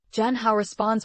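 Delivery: usual speaking voice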